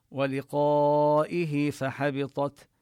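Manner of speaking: ولقائه فحبطت with the alif in ولقائه drawn four beats, slowly; ولقائه فحبطت recited slowly, the long alif held; four-beat madd. Shown as a demonstration. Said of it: "نمد الألف أربع حركات".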